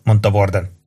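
ordinary delivery

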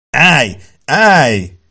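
urgent, high, loud yell; roared command. A narrator's reading. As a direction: yell at an attacker to halt. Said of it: "ordította Sloan is, Davenport is". (ordított, sürgető parancs)

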